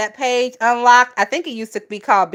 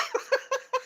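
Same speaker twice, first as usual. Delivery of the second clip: laugh; 13 semitones higher, 14 dB quieter than usual. Real, not acted. Cracking up at chat comment.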